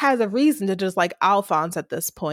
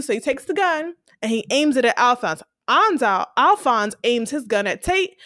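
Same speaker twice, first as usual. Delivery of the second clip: ordinary talk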